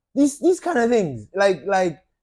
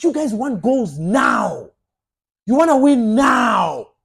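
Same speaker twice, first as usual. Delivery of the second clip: heated shout; 4 semitones higher, 5 dB louder, slower than usual. Real, not acted. Ranting at demanding fans.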